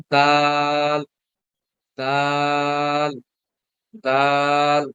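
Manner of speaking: দাল held long on the vowel, slow; drawn out long. Shown as a demonstration. Teacher showing students how দাল is read long, drawn out. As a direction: stretch দাল out each time, repeating it. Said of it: "লম্বা করে পড়তে হবে।".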